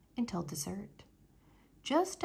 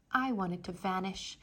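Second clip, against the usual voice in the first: plain reading voice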